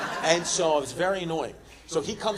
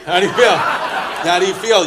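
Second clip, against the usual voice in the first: pushy repeat; 9 dB louder than usual. Acted. Repeating question at audience.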